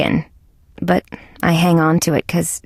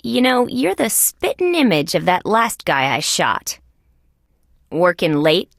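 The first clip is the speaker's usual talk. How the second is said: ordinary talk, nothing marked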